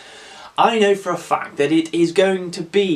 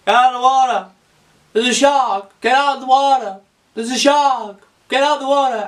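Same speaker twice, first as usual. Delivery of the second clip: loud, high warning yell; hammed-up swimmer's panic; panicked shouting; 7 semitones higher, 3 dB louder than usual. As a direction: yell a mock-frantic alarm across the water. Acting out panicked surfer's warning.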